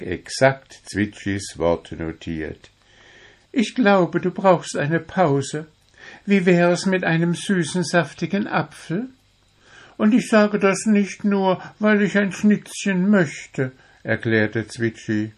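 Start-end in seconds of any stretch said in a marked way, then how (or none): 3.5-13.7 very high, coaxing bird voice; little blue bird. Acted. Little bird suggests a break to the dwarf, coaxing. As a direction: pitch it high as a small bird.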